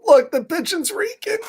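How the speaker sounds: bright, high call to look; excited. Acted. Reader calling children to look at the smelly pigeon, excited. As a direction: point at the page with glee.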